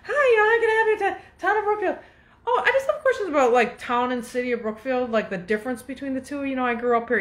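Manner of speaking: high, sugary mock clerk voice; Stepford wife voice. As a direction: play the sweet fake-helpful clerk. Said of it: "She's typical Stepford wife".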